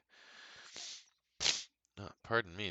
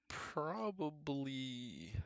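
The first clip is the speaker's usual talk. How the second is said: weary drawl; 7 semitones higher, much slower than usual. Real, not acted. Drawn out, then a sigh.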